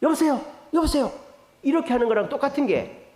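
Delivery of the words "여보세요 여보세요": quick high hellos into silence; acted confusion on the phone; puzzled phone hello. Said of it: "상대방이 얘기가 없으면".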